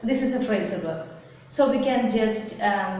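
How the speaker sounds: ordinary delivery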